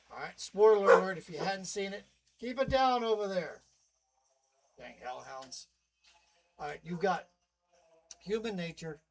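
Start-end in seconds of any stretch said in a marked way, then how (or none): 2.4-3.6 yell. Real, not acted. Yelling at noisy hellhounds. 4.8-5.7 grumble. Real, not acted. Grumbling about hellhounds.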